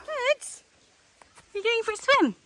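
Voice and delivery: high sing-song pet voice; talking to a dog. Real, not acted.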